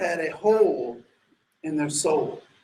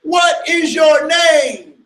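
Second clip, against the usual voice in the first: high, loud, very slow demand; a final furious question; shouted demand; 6 semitones higher, 5 dB louder, much slower than usual. Retold or imitated. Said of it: "And he says".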